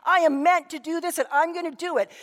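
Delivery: put-on a furious young woman standing her ground; mock angry retort; defiant outburst. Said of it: "she went ballistic on him".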